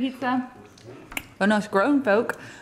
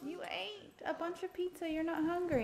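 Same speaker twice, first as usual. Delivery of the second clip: hushed and much softer; quiet aside; 5 semitones higher, 15 dB quieter than usual. Real, not acted. Mother aside, voice dropped low.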